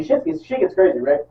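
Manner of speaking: ordinary talk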